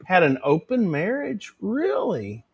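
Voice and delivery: high, drawn-out mock shock; mock scandalized. Host teasing a viewer for gossip about Dolly, mock scandalized. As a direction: play the nosy gossip, pitched well up.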